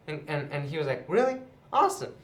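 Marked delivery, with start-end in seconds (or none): 1.1-2.1 much higher, thrilled squeal of relief; overjoyed at being let off; giddy 'Really? Awesome'; happy disbelief. Retold or imitated. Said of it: "he left so happy".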